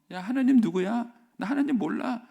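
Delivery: loud, high scornful sneer; a king mocking a god he doesn't know; arrogant Pharaoh. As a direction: sneer at Moses as a haughty king. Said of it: "이렇게 말하면서 얘기하는 거예요".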